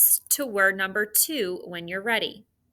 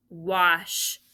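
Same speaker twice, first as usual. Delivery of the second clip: normal talking voice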